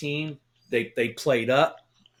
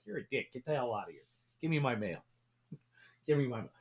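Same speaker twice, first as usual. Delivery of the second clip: mock grumpy; 7 dB quieter, much faster than usual. Retold mock-grumpy reply to mailman.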